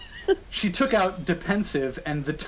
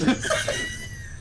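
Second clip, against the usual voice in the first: high shrieking laugh; squealing laugh. Real, not acted. Reader cracking up at fanfic.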